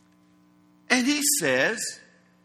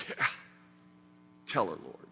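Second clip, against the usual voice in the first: smug aside; 9 dB quieter, faster than usual. Retold or imitated. Disciples voiced egging Jesus on.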